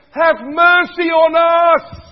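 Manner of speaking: loud, high, slow plea; crying out. Retold or imitated. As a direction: cry out for mercy with full force. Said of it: "They cried out".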